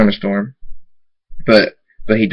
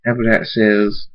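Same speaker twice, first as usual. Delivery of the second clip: usual speaking voice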